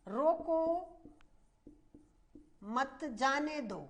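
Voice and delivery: रोको firm and set apart, long pause before मत जाने दो; emphatic रोको, then a clear break; stress on रोको. Shown as a demonstration. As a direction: say रोको, stop, then मत जाने दो as its own phrase. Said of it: "वाक्य में जोर देने के लिए".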